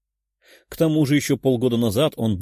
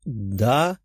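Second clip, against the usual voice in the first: a hesitant yes with an awkward smile; mildly sheepish. A narrator's reading. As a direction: answer shyly, a little drawn out. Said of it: "неловко заулыбалась она".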